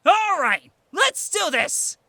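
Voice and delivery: loud, high battle cry; excited shout. A narrator's reading. Boy shouting near his partner's ears before take-off, excited shout. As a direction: shout it out, pitch way up. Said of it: "do not shout when you are so close".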